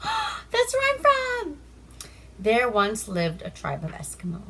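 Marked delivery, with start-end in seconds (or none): 0.0-1.6 excited aside about her own hometown; surprised gasp. Real, not acted. Narrator gasps at own hometown.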